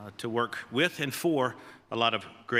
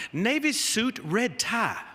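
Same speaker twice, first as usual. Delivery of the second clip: slow, higher Southern drawl, lightly put on; Coach Broyles drawl; 7 semitones higher, much slower than usual. Head coach telling new assistant what to wear, slow higher Southern drawl. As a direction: draw out navy suit like the old coach. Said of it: "In that sweet Southern drawl".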